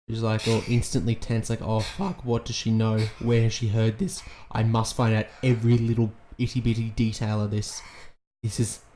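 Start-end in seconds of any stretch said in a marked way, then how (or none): none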